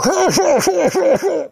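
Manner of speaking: very high, pulsing laugh; imitated cackle. Woman laughing above the boy underwater, high cackle. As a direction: mimic her hooting laughter. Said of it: "I'm hearing underwater".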